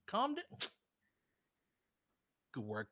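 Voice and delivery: ordinary talk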